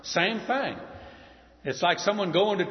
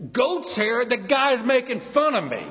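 higher and louder, indignant and insulted; fuming king; 8 semitones higher, 5 dB louder than usual. Retold or imitated. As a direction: spit it out like a mocked king. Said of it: "He's fuming".

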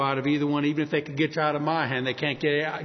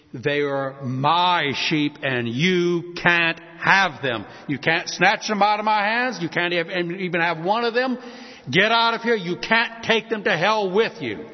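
Jesus defying Satan; 3 semitones higher, 5 dB louder than usual. Acted. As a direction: drive the enemy off from what is yours. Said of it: "he holds us in his arms and says".

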